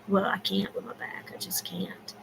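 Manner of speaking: barely voiced, sad whisper; whispered regret. Real, not acted. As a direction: let it sink to a whisper, resigned. Sad about her back, golf.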